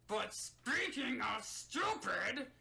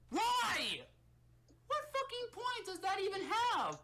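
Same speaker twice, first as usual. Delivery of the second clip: high, exasperated yell; exasperated outburst; 10 semitones higher than usual. Real, not acted. Critic ranting at a pointless twist, high exasperated outburst. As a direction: burst out in disbelief, pitched way up.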